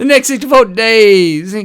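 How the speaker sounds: loud, high mimicked Southern drawl, stretching the words; Kamala's Southern accent. Host mocking a candidate's Detroit speech, exaggerated Southern accent. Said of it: "This, she talks that way".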